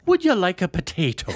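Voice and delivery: loud, high, bad Irish accent; hammed-up fake Irish; worst Irish accent. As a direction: commit fully to a terrible Irish lilt. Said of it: "with my worst Irish accent".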